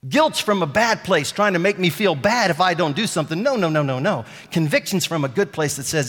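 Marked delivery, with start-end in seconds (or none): none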